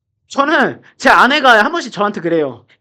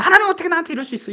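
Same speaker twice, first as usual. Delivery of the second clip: high, rushed protest to God; mock resentful complaint; 7 semitones higher, faster than usual. Petted believer still snapping at God, mock resentful complaint. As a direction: blurt out a hammed-up grievance. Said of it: "막 이렇게 원망하다가".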